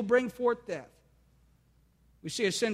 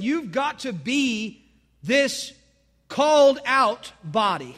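slow, much louder "called out body"; emphatic preaching climax; 4 semitones higher, 6 dB louder, much slower than usual. Acted. Preacher hammering the church's identity, slow and loud. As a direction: drive home "called out body" slowly and loudly.

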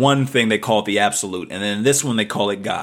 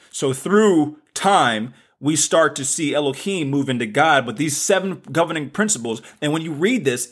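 ordinary delivery

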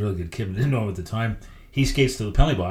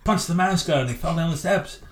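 high, raised voice of an angry governor; irate governor; 9 semitones higher than usual. Retold or imitated. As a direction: play the fuming team boss, pitched up. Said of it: "He said".

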